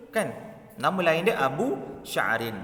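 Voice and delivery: ordinary talk, nothing marked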